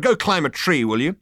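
loud, high brush-off; angry dismissal. Acted. Zaphod tells off Arthur, angrily dismissive. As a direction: dismiss them sharply.

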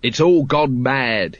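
ordinary delivery

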